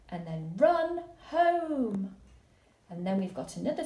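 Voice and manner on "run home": high, loud call to run; urgent exclamation. Shown as a demonstration. Teacher modelling an exclamation mark, high and urgent. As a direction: say it like you must hurry home. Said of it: "exclamation mark because we want to get home really, really quickly".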